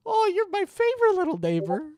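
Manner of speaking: very high squeaky cooing; squeaky baby talk. Pretend. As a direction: squeal it like fussing over a puppy.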